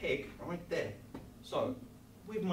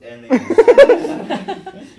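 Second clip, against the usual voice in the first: laughing; 21 dB louder, much slower than usual. Real, not acted. Laughing mid-demo on camera.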